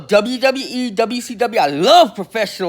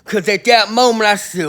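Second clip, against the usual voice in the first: normal talking voice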